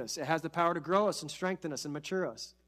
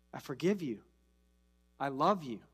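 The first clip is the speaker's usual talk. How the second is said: tender; 5 semitones lower, 5 dB quieter than usual. Retold or imitated. Tender quote of Jesus.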